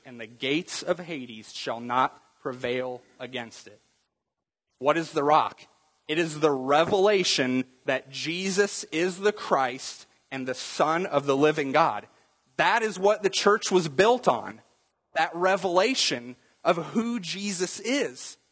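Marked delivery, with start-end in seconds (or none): none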